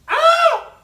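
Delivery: very high, very loud shriek; kids' terrified scream. A narrator's reading. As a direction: shriek in terror. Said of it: "Jared and I both screamed".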